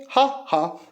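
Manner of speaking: ordinary delivery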